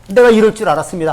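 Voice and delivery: loud, fast huff; angry outburst. Retold or imitated. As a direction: snap it out in a temper. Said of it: "막 화를 내면서 성질을 내면서".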